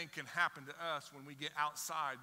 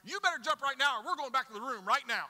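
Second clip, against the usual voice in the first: much louder, higher scolding threat; frustrated dad; 8 semitones higher, 10 dB louder than usual. Retold or imitated. Dad losing patience with a child on the dock, angry threat. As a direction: snap at the hesitant kid. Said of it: "we get a little frustrated, and we're like".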